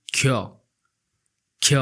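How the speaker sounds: ख्य said as kh-ya, clearly, not as स; Odia letter khya. Shown as a demonstration. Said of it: "ख्य बोलते हैं". (ख्य को ख और य मिलाकर साफ़ बोलें)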